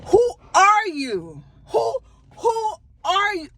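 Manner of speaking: high, drawn-out "who are you"; incredulous, stretched-out questioning; mock indignant challenge. Acted confrontation of nosy coworker.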